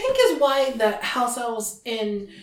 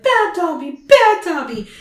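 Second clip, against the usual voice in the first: high, loud cry of bad Dobby; self-scolding shout; 8 semitones higher, 8 dB louder than usual. House elf punishing himself, a high loud self-scolding shout. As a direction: scold yourself at the top of your voice.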